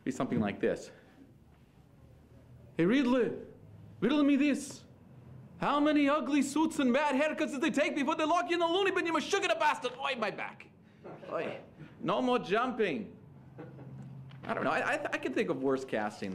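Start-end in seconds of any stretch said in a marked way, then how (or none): 2.8-13.1 high, kvetching superhero bluster; hammed-up Yiddish-flavored Batman, pitched way up.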